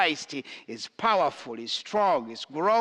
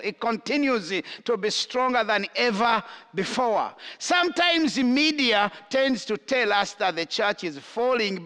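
raised, higher preaching voice proclaiming good news; 6 semitones higher than usual. Acted. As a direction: lift it up like a pulpit declaration. Preacher rises to full force.

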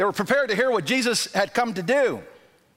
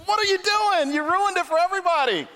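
exasperated; 12 semitones higher than usual. Retold or imitated. Friends scolding the guy who ruined the surprise, exasperated. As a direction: chew him out for ruining it. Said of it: "And it's like".

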